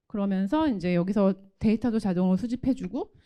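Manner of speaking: ordinary talk, nothing marked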